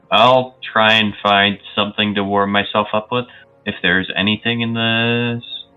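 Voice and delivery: ordinary talk, nothing marked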